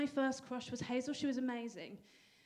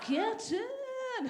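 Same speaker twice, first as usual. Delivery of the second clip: mum's cheer; 8 semitones higher than usual. Retold or imitated. Mum's cheer, high and far.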